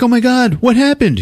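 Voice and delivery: mock panicked woman, very high; shriek-pitched alarm, hammed up. Wife seeing her husband covered in blood, alarmed.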